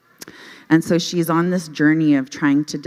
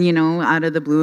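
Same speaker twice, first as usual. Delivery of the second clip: ordinary delivery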